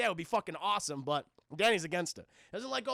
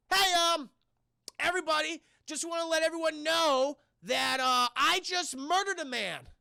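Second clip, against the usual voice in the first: higher, louder, cheerful public announcement; excited announcement; 7 semitones higher, 4 dB louder than usual. Retold or imitated. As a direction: announce the murder like happy news. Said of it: "He's so excited".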